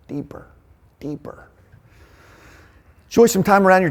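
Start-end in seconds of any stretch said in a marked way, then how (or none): 0.1-1.5 "deeper" echoed, then whispered away much softer; fading whisper. Acted. Leader urges the men to go deeper, fading to a whisper. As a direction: let the word sink into a whisper.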